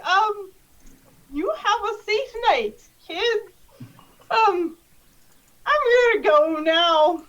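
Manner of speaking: high, hesitant goodbye with awkward gaps; nervous. Acted. Candy man excuses himself from the kids, nervous. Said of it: "so nervous".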